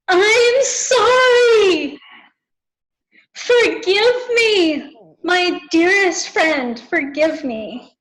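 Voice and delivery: drawn-out, high wailing apology, easing down; tearful yelling. Retold or imitated. Imitating a friend yelling apologies into the phone, tearful yell. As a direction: wail it slowly, imitating a crying yell. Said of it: "You started yelling".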